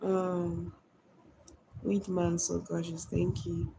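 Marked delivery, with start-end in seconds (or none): none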